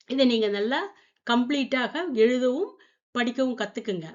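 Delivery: ordinary delivery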